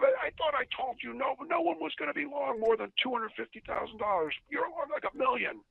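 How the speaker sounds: high-pitched imitation of an irate boss; mock yell, strained high in disbelief. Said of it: "Why are you yelling at me".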